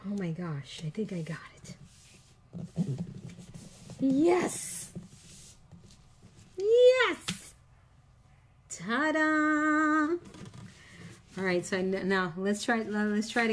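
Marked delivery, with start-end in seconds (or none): 0.1-1.8 hushed whisper of relief; softer whispered oh my gosh. Real, not acted. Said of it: "Nobody say a word". 4.0-10.2 much higher, louder cheer; squealing yes yes then ta-da; triumphant. Real, not acted. Celebrating a successful punch.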